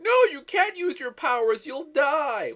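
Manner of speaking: high, loud mocking whine of a teen; whiny teenager. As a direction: whine it like a sulky kid. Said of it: "A bunch of whiny little bitches going".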